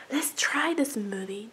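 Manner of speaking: unvoiced whisper. Real, not acted. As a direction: whisper as if sneaking a taste. Whispered before tasting.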